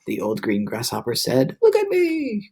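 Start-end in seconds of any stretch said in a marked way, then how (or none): 1.6-2.5 excited shout. A narrator's reading. Centipede freed by the rain, high excited shout. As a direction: crow with delight. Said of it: "cried the centipede".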